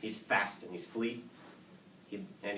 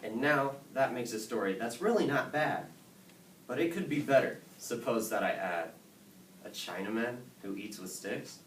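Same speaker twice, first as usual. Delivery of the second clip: plain reading voice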